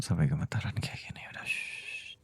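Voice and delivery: hushed, shushing reassurance; quiet her gently, all in a whisper; soothing whisper. Acted. Wife trembling, husband soothing. (bisikan menenangkan istri)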